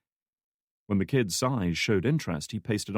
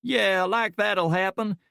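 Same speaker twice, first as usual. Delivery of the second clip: a big man's much higher voice, drawn out in disbelief; slow sarcastic reply, pitched up high; the checked shirt man mocks the idea, slow and high-pitched; high-pitched, slow scoff; 12 semitones higher, 4 dB louder, slower than usual. A narrator's reading. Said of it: "his voice strangely high-pitched".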